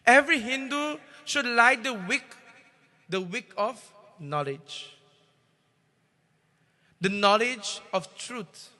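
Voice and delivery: a temple preacher rousing his devotees; fervent sermon. Acted. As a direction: preach it out, swelling loud on the key words.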